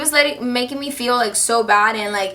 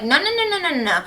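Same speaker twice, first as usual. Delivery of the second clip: high-pitched start, dropping away; mock a teacher brushing someone off; imitated dismissive teacher; 7 semitones higher, faster than usual. Teacher refusing to hear her student, mock dismissive. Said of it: "And she's like".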